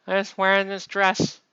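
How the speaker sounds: high, loud hammed-up greeting; mock cheery high-pitched character voice; simple goddess voice. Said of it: "She's like".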